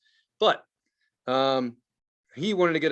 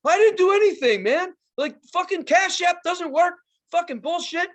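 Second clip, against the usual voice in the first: much higher, louder whiny protest; whiny defensive friend; 12 semitones higher, 5 dB louder than usual. Retold or imitated. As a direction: whine like a guilty friend caught out. Friend's defensive reply retold.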